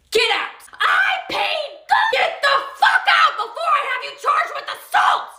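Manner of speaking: screaming fight. Acted. Cashier screams at the customer to get out, shrieking yell. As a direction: scream them out of the store.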